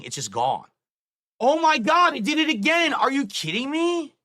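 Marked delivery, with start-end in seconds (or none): 1.4-4.1 much higher and louder, outraged shock; exasperated disbelief. Real, not acted. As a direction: yell it at the screen as it breaks again. Stream setup breaks again.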